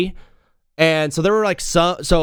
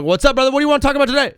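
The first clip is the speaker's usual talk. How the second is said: super fast; 10 semitones higher, much faster than usual. Retold or imitated. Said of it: "Super fast".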